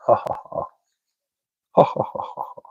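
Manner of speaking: breathy, voiceless ha-ha-ha; mock laugh. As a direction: fake a laugh in short puffs of air. Reads out a chat laugh.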